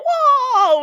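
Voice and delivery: high, loud falling "Whoa"; Mario whoa. Retold or imitated. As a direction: cry it like Mario dying. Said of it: "I don't wanna hear that".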